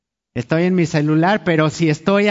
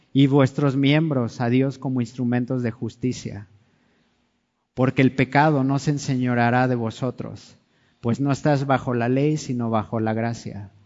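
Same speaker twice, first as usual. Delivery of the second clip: measured, lower Bible reading; scripture reading; 5 semitones lower than usual. Acted. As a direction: read the verses solemnly and steadily. Reads scripture passage aloud.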